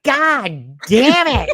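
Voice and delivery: high, loud, drawn-out mock roar; angry outburst. Retelling a movie villain throwing things around, angry outburst. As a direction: stretch it out in an imitated yell.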